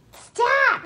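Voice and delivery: loud, very high stop; high sharp cry to the dog; squealed command. Real, not acted. Tells wriggling dog to stop.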